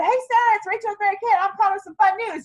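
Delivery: an eager, upbeat call full of enthusiasm; excited phone voice. Shown as a demonstration. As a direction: sell the fun news like you can't wait. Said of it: "and I'm excited".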